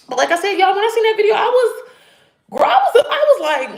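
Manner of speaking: climbing high, excited and loud; telling viewers how the video shocked her, excited. Real, not acted.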